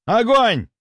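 high shouted command to fire; shouted order. Acted.

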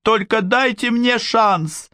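high, slow pleading; pleading girl. A narrator's reading. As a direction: beg for one chance. Girl begs for a chance.

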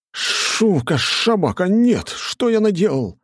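high, loud hammed-up despair; mock pathetic cry. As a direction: overact the dismay. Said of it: "патетически воскликнул я". (театральное патетическое восклицание)

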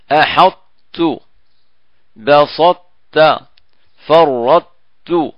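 أحطت بسطت فرطت very slow, keeping the emphatic ط before ت; partial merge. Shown as a demonstration. Teacher demonstrates partial assimilation, very slowly. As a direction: draw out each word, keep the heavy ط. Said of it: "يسمى إدغام ناقص".

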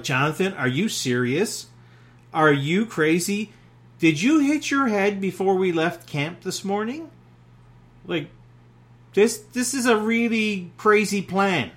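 mocking. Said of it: "is mocking".

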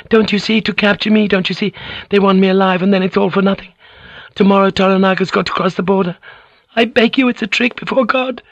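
very high, frantic begging; frantic pleading. Acted. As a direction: beg him, near panic. Mariko continues frantic pleading.

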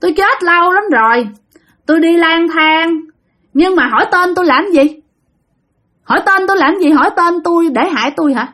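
high, droning spirit voice; wandering ghost, wary of being questioned; possessed grandmother answering. Retold or imitated. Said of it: "Trả lời vậy nè".

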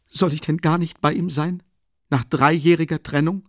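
high, loud tearful protest. A narrator's reading. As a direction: plead as if close to crying. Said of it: "weinte Pulcheria Alexandrowna".

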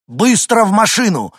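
high, loud command; urgent shout. Acted. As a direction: shout them into the car. Мужчина загоняет семью в машину. (громкая срочная команда)

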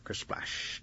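soft unvoiced "kersplash"; imitated splash. Rabbit throws rocks at the pond, splash noise. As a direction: whoosh it like water.

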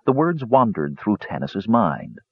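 plain reading voice

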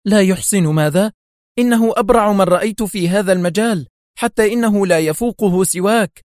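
high, incredulous exclamation; amazed, indignant defence; astonished. A narrator's reading. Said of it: "في دهشة وهو يهتف".

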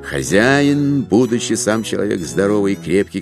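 ordinary delivery

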